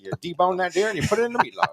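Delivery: put-on high, fast old-time pitchman; old guy voice. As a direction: sell it like a vintage commercial. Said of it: "an old guy voice".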